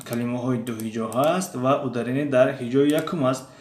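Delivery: ordinary talk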